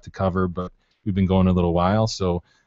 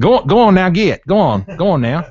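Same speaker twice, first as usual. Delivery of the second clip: put-on Southern accent; 8 semitones higher, 6 dB louder than usual. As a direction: shoo them away in a thick Southern twang. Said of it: "You gotta get your Southern accent".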